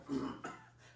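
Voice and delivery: short follow-up cough; a small cough to clear the chest; real cough. Real, not acted. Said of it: "I have some that I need to go".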